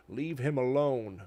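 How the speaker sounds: slow, eerie warning; spooky townsperson. Retold or imitated. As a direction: murmur it like a creepy villager. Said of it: "spooky townspeople who are like".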